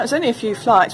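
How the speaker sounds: normal talking voice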